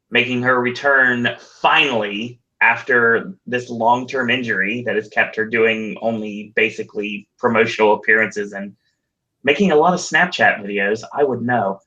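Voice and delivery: ordinary talk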